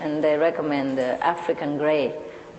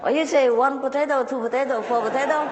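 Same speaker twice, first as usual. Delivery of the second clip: plumber quoting; 8 semitones higher than usual. Retold or imitated.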